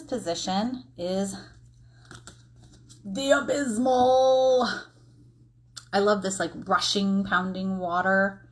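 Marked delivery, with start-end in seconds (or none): 3.0-4.7 loud, high, drawn-out theatrical reveal; mock-dramatic announcement. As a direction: announce it grandly, stretching the word right out.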